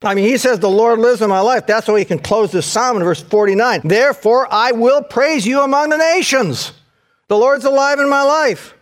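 preaching at full force. Acted. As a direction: build to the final shout of praise. Climactic preaching on Psalm 18.

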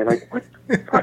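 ordinary talk

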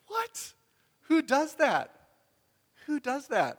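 high, loud, incredulous what?; astonished. Retold or imitated. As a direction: squeal it out in disbelief. Narrator's own disbelief, retold.

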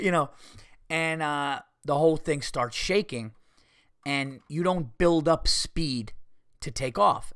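ordinary talk, nothing marked

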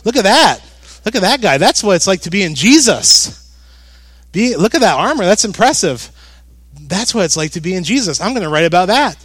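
a pastor spotting the perfect illustration; gleeful Paul pointing at the guard; excited preacher Paul. Retold or imitated. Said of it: "and he says".

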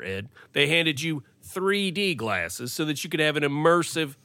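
ordinary talk

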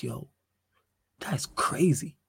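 breathy stunned whisper. Real, not acted. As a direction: hush it in disbelief. Stunned aside after stats.

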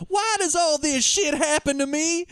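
high, slow Southern whine; hammed-up Southern accent. Shaken-down fence complains to the cops, hammed-up Southern accent. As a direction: plead slowly in a mock Southern accent. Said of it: "trying to do some kind of Southern thing".

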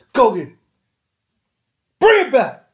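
high, loud dog commands; loud, high commands to a fetching dog; commanding the dog. Retold or imitated. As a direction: send the dog off and call it back.

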